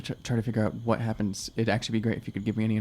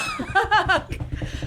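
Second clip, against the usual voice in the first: nervous laugh. Real, not acted. Caught out by the guard's question.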